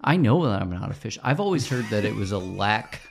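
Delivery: ordinary delivery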